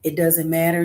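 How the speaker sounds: ordinary talk, nothing marked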